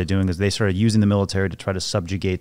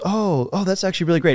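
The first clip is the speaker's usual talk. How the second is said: much higher-pitched, imitated chummy approval; suddenly super friendly; 10 semitones higher than usual. Exposed faker trying to win over the real one, suddenly chummy. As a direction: mimic a sudden switch to eager friendliness. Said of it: "starts getting super friendly and super chummy".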